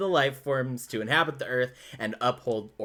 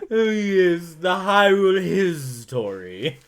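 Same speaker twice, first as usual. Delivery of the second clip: slow, louder put-on snooty voice; mock-pompous pronunciation; 4 semitones higher, 5 dB louder, slower than usual. Joking along with co-host's mishearing, mock-pompous. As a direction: draw it out with hammed-up grandeur, History as his-TOR-ee.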